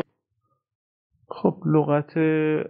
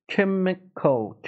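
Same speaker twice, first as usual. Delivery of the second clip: Chemical very slowly, syllable by syllable, higher; 6 semitones higher, much slower than usual. Shown as a demonstration. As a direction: stretch Chemical into three clear parts. Teacher slows word for demonstration.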